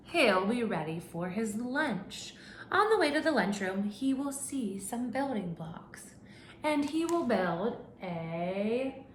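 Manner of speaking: slow, drawn-out storybook voice with suspense; reading to children. Acted. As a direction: build suspense for little listeners. Reads picture book to class.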